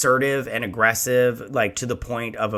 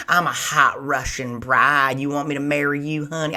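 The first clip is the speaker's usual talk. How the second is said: put-on Southern accent. Scam bride to a lonely man, Southern accent. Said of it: "giving him a southern accent".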